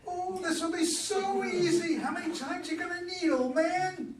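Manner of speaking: put-on smug high teen voice, dragged out; cocky teenage boy. Boy mocks Joe's challenge.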